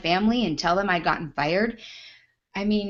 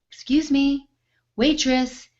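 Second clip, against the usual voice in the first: entitled customer calling the waitress; snooty summons; 6 semitones higher, slower than usual. Retold or imitated. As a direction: imitate her snapping for service.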